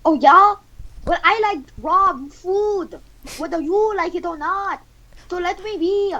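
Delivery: high, defiant caricature; slow high-pitched comic defiance; Gordon Ramsay. Acted. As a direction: argue back in a high put-on voice. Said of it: "Gordon Ramsay says".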